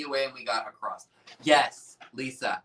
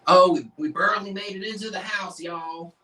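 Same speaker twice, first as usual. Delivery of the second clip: put-on Southern accent; 6 semitones higher, 5 dB louder than usual. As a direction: play it up as a Southerner. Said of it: "That almost sounds Southern to me".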